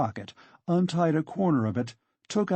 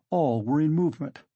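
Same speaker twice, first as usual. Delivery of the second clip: ordinary delivery, nothing marked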